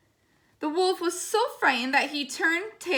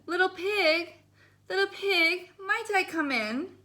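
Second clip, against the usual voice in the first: lightly higher sing-song wolf call; wolf coaxing; 6 semitones higher than usual. Acted. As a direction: coax the pig out, lightly voiced. Wolf calls sweetly at the door.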